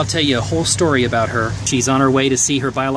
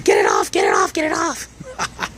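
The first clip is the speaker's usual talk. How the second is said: mock screaming truck; 13 semitones higher than usual. Driver voicing his smoking truck, a hammed-up squeal. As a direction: play the smoking truck yelling for the tire to come off. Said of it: "It's like my truck was screaming".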